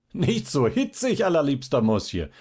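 high teasing call; mocking laughter. A narrator's reading. Fabian mocks the fallen little man, high and teasing. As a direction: tease him from high up.

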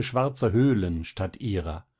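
ordinary delivery, nothing marked

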